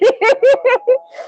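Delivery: high giggling laugh. Real, not acted. Streamer laughing at a Meatloaf slip, giggling. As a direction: giggle helplessly at your own slip.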